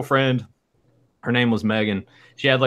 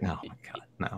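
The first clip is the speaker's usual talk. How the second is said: near-whispered imitation of dismay; exasperated refusal; 2 semitones lower, 16 dB quieter, faster than usual. Voices Emily's exasperated refusal.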